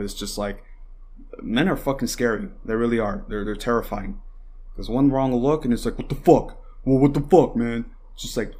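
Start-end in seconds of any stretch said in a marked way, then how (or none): 6.0-7.9 a hammed-up tough guy, louder; mild mock confrontation, louder; mock aggressive yell. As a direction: bark it like a guy picking a fight. Acting out aggressive man.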